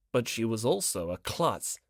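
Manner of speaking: plain reading voice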